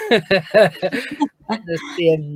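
slow words through a laugh; amused laughing aside. Real, not acted. Laughing while telling.

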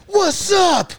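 high shouted what's up; rough greeting shout. Retold or imitated. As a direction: yell it like a street guy. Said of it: "Another homeless guy from behind me goes".

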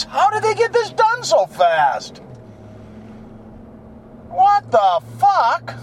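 high amazed disbelief. Real, not acted. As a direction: marvel at the smooth road. Amazed at new road.

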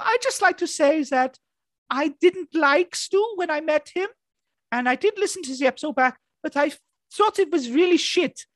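high squeaky falsetto pop-star voice. Acted. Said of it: "[name], coming to you next".

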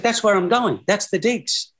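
lightly voiced Middlesbrough accent. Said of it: "Forgive my Middlesbrough accent".

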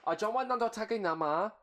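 high, loud put-on Filipino accent; mock Filipino accent. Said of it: "I could be like".

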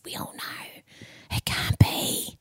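slow confiding whisper. Real, not acted. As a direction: lean in and whisper it. Confiding about marriages.

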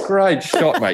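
high-pitched, thrilled cheer; excited dad. Retold or imitated. Dad cheering son's golf shot, excited imitation. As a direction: exclaim it high, imitating a delighted father. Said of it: "Dad's so excited".

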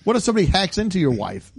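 normal talking voice

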